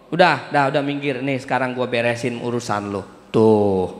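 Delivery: easy, settling tone, taking the problem off your hands; voicing Allah. Preacher voicing Allah as he steps in to fix things. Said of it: "dihargain sama Allah".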